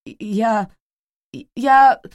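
stumbling, caught out; babbling stammer. Acted. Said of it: "залепетал Сесил".

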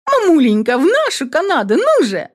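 high, bubbly urging; excited daughter. A narrator's reading. Daughter urging mom about Canada, high and excited. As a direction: coax mom, bouncing with joy.

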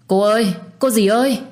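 high, loud call for help; urgent calling out. Acted. Passerby calling urgently to a collapsed woman, loud call.

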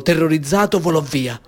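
ordinary delivery, nothing marked

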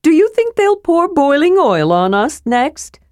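high, cheerful little character voice; cheerful Tasselhoff. A narrator's reading. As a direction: chirp it with carefree delight. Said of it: "said Tasselhoff cheerfully".

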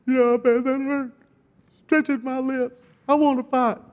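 very high, whimpering voice of a hurt man; mock crying. Crying best man complains about his stretched lips, mock crying. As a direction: imitate a man wailing through sobs. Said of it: "the best man's crying".

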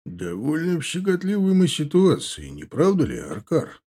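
sly, mocking orc; smug orc voice. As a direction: play the cocky young orc. Indgar's voice in audiobook.

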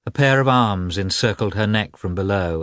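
ordinary delivery

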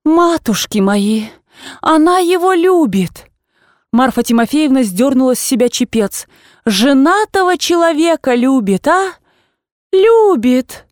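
put-on an old aunt who cannot believe her ears; shocked old aunt. Old aunt cries out at Liza's confession, shocked and rising.

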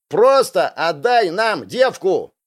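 high, slow drawn-out shout; yelling demand. A narrator's reading. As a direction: shout the demand, stretching each word. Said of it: "орут слева".

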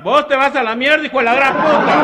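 very high, loud insulting yell; furious wife. Acted. Comedian voicing the wife cursing her husband in a high, loud yell. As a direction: scream the insult at him up high.

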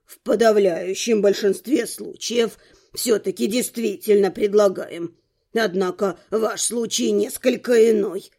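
put-on low, measured old man's voice; old rector. A narrator's reading. Rector explains how mages are recruited, measured old man.

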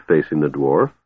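ordinary delivery

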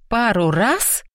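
sharp rising disbelief; incredulous echo. A narrator's reading. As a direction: echo it back, stunned. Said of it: "еле удержалась, чтобы не стукнуть".

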